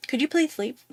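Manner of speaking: high, small-voiced plea; retold awkward request. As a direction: squeak out a polite request, mock-meek. Retold timid request to the actor.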